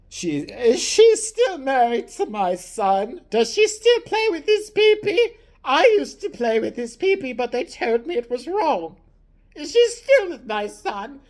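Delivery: put-on high, slow senile old queen; hammed-up doddering queen. Old queen asking the servant about her son, high and slow.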